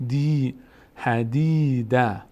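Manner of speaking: حدیده slowly, "dee" held; long vowel. Shown as a demonstration. Teacher showing dal before ya, long vowel. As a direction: stretch the ee in دی of حدیده. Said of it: "صدای ای".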